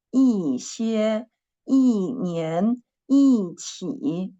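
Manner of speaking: teacher's demo of 一 with a falling tone; fourth tone on 一. Shown as a demonstration. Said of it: "We should read it the fourth tone".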